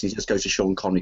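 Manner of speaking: ordinary talk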